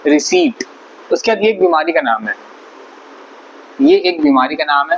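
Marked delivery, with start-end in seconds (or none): 0.1-0.6 रिसीट said riseet, p silent, raised; correct pronunciation. Shown as a demonstration. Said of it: "ऐसे पढ़ा जाएगा रिसीट". (रिसीट, पी silent, ऊँचे स्वर में)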